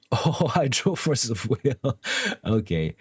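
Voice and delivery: words broken up by laughter; slow, giggly surprise at the draw; can't help laughing while talking. Real, not acted. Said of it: "I shouldn't laugh".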